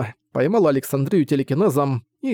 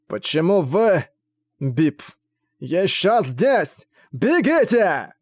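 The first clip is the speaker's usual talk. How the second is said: slow, strained, higher cries; running out of air; 5 semitones higher, much slower than usual. Acted. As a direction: gasp it out at the end. Dying man urges them away.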